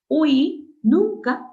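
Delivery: Ui nunca drawn out high and loud; teacher's slow high emphasis; slow emphatic. Shown as a demonstration. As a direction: stretch Ui nunca to drive the rule home. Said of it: "nunca se separa".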